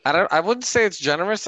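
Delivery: ordinary talk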